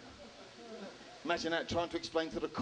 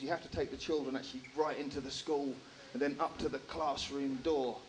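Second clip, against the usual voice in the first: ordinary talk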